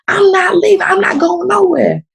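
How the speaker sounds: high, crying refusal; retold upset refusal breaking into tears; tearful defiance.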